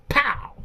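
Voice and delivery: a high, punchy pow; mock slap sound. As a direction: smack it out like a slap. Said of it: "slapped my dad".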